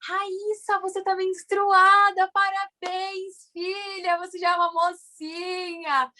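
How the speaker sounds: high, slow sing-song congratulation; imitating a delighted mom; gushing mother. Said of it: "Toda, toda assim".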